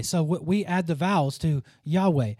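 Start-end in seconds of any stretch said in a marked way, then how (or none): none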